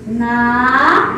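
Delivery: "Na" loud, long, rising at the end; first chunk "Na", drawn out and set apart; syllable by syllable. Shown as a demonstration. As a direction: stretch "Na" out firmly on its own. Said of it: "vamos separar a palavra nave".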